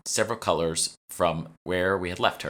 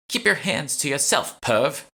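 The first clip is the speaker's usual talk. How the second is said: high, loud snap at him; scornful, loud rebuke; disgusted snap; 9 semitones higher, 5 dB louder, slower than usual. A narrator's reading. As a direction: shove him off with words. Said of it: "with a look of disgust".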